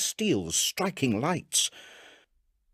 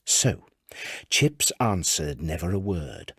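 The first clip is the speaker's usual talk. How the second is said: usual speaking voice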